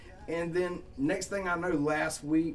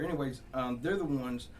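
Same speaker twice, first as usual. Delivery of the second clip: ordinary talk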